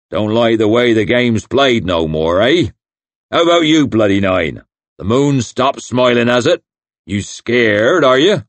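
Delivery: loud, put-on hillman taunt rising at the end; mock him loudly like a swaggering chief. Crummock taunts Logan about being scared, loud swaggering taunt.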